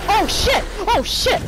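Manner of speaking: high, loud startled cry; startled scream. Real, not acted. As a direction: shriek it in sudden fright. Jump scare in game.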